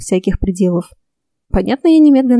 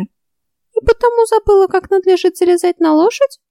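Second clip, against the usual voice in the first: skeptical fairy voice; 11 semitones higher than usual. A narrator's reading. Fairy Ulrika voiced high.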